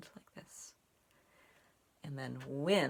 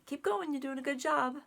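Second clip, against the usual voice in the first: high, louder, cheering someone on; encouraging cheer; 11 semitones higher, 5 dB louder than usual. Shown as a demonstration. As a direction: lift your voice to urge a friend on. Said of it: "when you want to tell someone".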